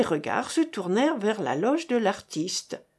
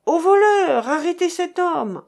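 high, loud alarm cry; shouting after a thief; 8 semitones higher, 5 dB louder than usual. A narrator's reading. Monsieur Hiller chasing the thief through the crowd, high shout. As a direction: yell it while running after him.

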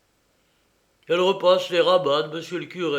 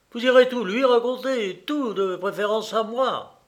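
raised, higher-pitched accusation; indignant, higher complaint; jealous reproach; 6 semitones higher than usual. Acted. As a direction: accuse her in a rising voice. Jealous brother accuses Clarisse.

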